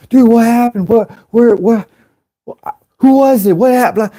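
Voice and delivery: very high, stammering mock alarm; frantic onlookers. People everywhere after the crash, frantically asking.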